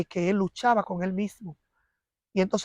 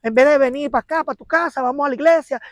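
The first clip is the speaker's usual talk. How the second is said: raised, higher voice telling him off; retold scolding; 6 semitones higher, 5 dB louder than usual. Wife reenacting scolding her drinking husband, raised.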